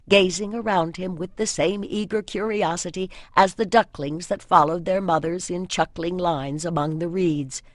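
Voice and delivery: ordinary delivery, nothing marked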